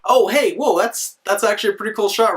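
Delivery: high, eager burst of interest; quickly thrilled by the shot; excited. Real, not acted.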